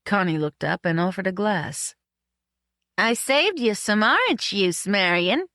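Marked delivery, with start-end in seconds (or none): 3.0-5.5 higher, pointed, lilting hint; meaningful hint. A narrator's reading. Woman offers drinking buddy juice, meaningfully. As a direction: say it meaningfully, with a knowing lift. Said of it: "she said meaningfully".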